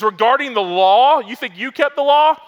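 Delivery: high, loud, building challenge; fervent boast. Retold or imitated. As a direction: rise up and throw it at them. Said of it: "He says".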